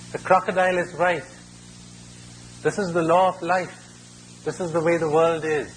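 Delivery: storyteller lightly voicing a bird's ruling; bird's verdict. Bird confirms the crocodile's verdict.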